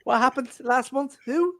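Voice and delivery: mock Ronaldo. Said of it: "I'll be like".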